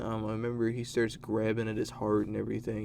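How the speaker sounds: ordinary delivery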